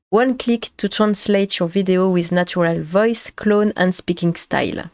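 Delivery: careful English with a thick French accent; earnest English reading, heavily French-accented; best English, French accent. Shown as a demonstration. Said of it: "utiliser mon meilleur accent".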